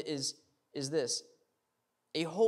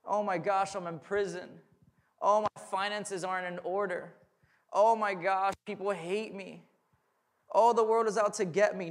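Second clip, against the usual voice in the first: mock self-pity; 4 semitones higher, 4 dB louder than usual. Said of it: "where he is saying".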